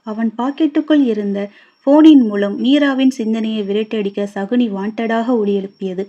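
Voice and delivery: ordinary delivery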